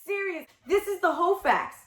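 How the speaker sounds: high-pitched, disbelieving protest; incredulous outrage. Retold or imitated. Woman reacting to partner's failure in bed, incredulous outrage. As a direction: act out being indignant and incredulous.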